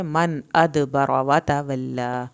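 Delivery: slow, loud, drawn-out tajweed chant; Quran recitation. Shown as a demonstration. Teacher recites aloud for students, slow loud chant. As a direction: chant each word slowly for the class to follow. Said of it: "دال کا قلقلہ".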